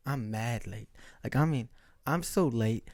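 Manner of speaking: ordinary talk, nothing marked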